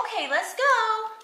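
high, slow pretend mommy. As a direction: coax the baby along, high and slow. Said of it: "Okay, I'll be the mommy".

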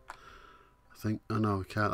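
ordinary talk

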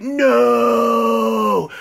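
long, high, loud wail of denial; drawn-out scream. Retold or imitated. Man learning she has no shoes, melodramatic scream. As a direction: scream it out melodramatically and hold the vowel. Anguished reaction to missing shoes.

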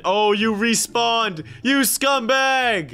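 high, drawn-out accusation; slow, rising outraged yell; indignant shout. Real, not acted. Indignant at rival's return.

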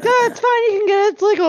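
high-pitched mom impression; imitated mom. Mom tells her worried kid it's legal, imitated reassurance. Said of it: "Mom's like".

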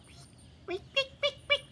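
very high peek peek; tiny cricket chirping, very high; cricket chirps. Retold or imitated. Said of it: "the crickets go".

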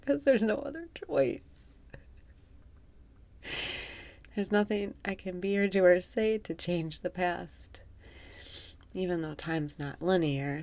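guided meditation. Acted. Guiding listeners through breathwork in a soft meditation voice. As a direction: guide the breath calmly, half amused.

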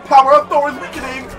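hammy distress. Pretend. Thor losing the fight.

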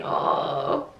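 ordinary talk

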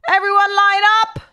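loud, high gym teacher command; PE teacher shout. Pretend. Streamer playing a PE teacher ordering the class to line up, loud high shout. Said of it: "I wanna be a P E teacher".

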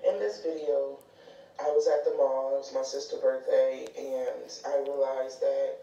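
low-pitched reflective commentary; explanatory voice-over. Real, not acted. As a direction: look back on it in a low, subdued voice.

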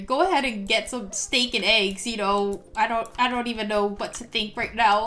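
ordinary talk

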